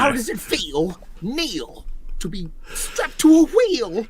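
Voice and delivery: very high comic English voice; high-pitched, hammed-up English accent. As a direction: squeal it in a mock English accent. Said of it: "Can you all do English accents?".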